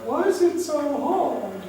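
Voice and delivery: high, slow whining plea; whiny complaint. Retold or imitated. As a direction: whine it like a worn-out believer. Congregation voiced as whining.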